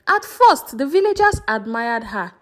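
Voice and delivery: plain reading voice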